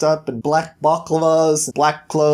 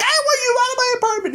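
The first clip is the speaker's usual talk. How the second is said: very high, fast woman's voice, kicking him out; a furious tenant squealing him out the door; angry woman; faster than usual. Acted. Woman throwing Prince Charming out of her apartment, high and fast. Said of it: "And she's like".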